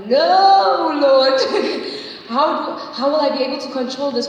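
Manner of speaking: refuse, throwing it back at God; loud protest. Retold or imitated. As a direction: mock-desperate outburst with a laugh.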